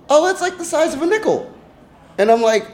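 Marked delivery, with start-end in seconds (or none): 0.1-1.5 very high-pitched mimicry of a friend; squeaky-high retold retort; imitated friend. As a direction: mimic her way up high, off your own voice. Retelling friend sizing up the hole.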